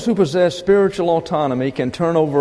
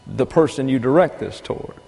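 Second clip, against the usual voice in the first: ordinary talk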